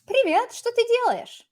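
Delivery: high-pitched friendly question; dialogue line. Acted. Opening a little example dialogue with a friend, high-pitched greeting. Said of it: "this is a little dialogue".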